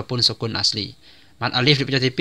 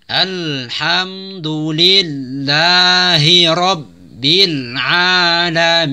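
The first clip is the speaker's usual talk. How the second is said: very slow melodic recitation of Bismillahirrahmanirrahim; Quran recitation; 3 semitones higher, 3 dB louder, much slower than usual. Shown as a demonstration. Teacher models full recitation, slow melodic chant. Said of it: "Ayat berikutnya".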